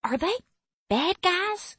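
very high Southern twang; Southern accent. A narrator's reading. Frightened girl leans in to ask, high Southern accent. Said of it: "her voice twangy with a southern accent".

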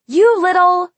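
high, loud, cut-off insult; furious. A narrator's reading. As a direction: start an outraged insult at full pitch. Marcy starts insult, cut off.

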